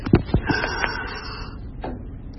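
long soft breathy exhale; one drawn-out wordless breath, very quiet; imitated last breath. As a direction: act out a dying person's final breath. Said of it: "that was her last breath".

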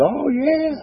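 very high, squeaky-pitched confession; eager admission. Retold or imitated. Speaker owning up to the boy about gasoline, eager high admission.

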